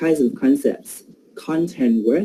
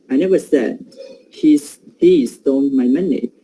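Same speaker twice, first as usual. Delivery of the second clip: "he" picked out as the prominent word; stress on he. Shown as a demonstration. Teacher showing the class prominence, stress on "he". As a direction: make "he" stand out, as if another person was accused. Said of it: "he is giving prominence".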